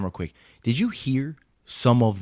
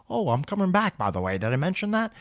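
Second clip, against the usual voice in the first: high, breezy mock-innocent voice; mock Brady; 7 semitones higher than usual. Host mocking the quarterback's comeback, high coy voice. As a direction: play it coy and smug.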